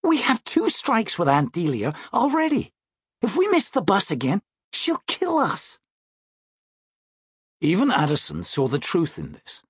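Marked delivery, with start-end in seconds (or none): 0.0-5.8 put-on high, urgent kid-sister voice; worried little sister. Sister warning her brother about their aunt, high and urgent. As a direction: warn him in a high child's voice.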